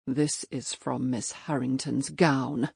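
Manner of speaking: low, slow and hushed; quietly. A narrator's reading. Robert quietly to the innkeeper, low and hushed. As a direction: say it quietly, holding back anger. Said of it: "Robert said quietly".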